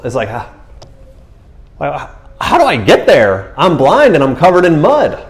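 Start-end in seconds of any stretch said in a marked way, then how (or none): 2.4-5.3 high, loud, hammed-up bewilderment; exasperated protest. Mud-covered blind man asked to go wash, high exasperated wail. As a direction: wail it in mock helplessness.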